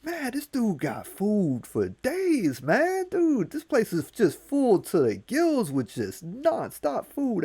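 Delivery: high, slow amazed voice; high awed trickster; Anansi amazed. Acted. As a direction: marvel at the piles of food. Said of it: "He's like".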